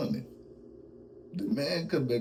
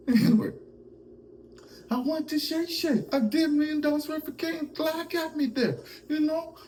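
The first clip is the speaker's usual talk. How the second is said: resumed high-pitched comic impersonation. Acted. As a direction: get back into the squeaky, mock-defensive voice. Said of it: "He break character".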